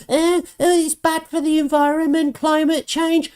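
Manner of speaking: high, whiny mock voice of a complaining activist. Ranting vlogger mocks climate activists, high whiny mock voice. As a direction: sneer it out like a fussy protester.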